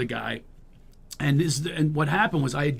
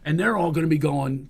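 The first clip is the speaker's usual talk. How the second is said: ordinary delivery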